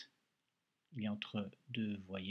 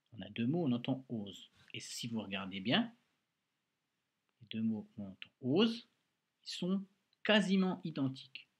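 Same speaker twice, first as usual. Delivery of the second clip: ordinary delivery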